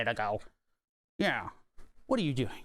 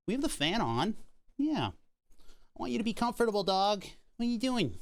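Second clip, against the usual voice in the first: much higher coaxing voice to the dog; high puppy talk; 8 semitones higher than usual. Real, not acted.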